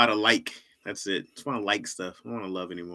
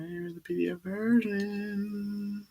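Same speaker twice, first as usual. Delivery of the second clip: high, slow put-on voice; silly voice; 11 semitones higher, 5 dB quieter, much slower than usual. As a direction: drawl it out in a goofy high voice.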